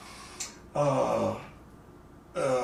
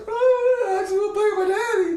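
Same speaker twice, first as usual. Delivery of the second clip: put-on little boy; 5 dB louder, faster than usual. Little son answering the great musician, high child wail. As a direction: imitate a small boy, loud and very high. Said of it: "so little Mark's like".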